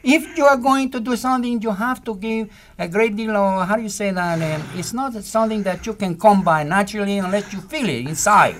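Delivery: put-on lightly held Spanish-accented Pedro voice; earnest Pedro explaining feeling; a hint of a Spanish accent; Pedro's Spanish accent. Speaks as Pedro.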